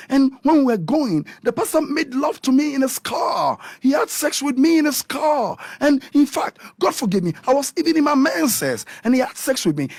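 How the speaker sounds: high-pitched, heated account; indignant retelling. Retold or imitated. Telling what the pastor did, high and indignant. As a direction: pour out the complaint, pitched well up.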